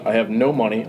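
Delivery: usual speaking voice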